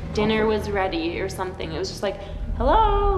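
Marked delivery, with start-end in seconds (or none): 2.6-3.2 high, louder sing-song call; grandma calling out. Retold or imitated. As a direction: call out like dinner is ready. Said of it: "grandma was just calling out to me".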